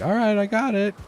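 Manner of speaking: high, loud, breezy "got it" voice; imitating stepmom. Stepmom filming, breezy high imitated voice. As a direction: play the stepmom as she confidently starts filming. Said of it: "And she's like".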